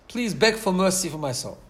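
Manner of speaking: ordinary talk, nothing marked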